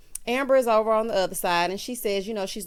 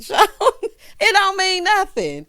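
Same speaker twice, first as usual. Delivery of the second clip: laughing; 11 semitones higher, 9 dB louder than usual. Real, not acted. Laughing at Joel's flirty accent.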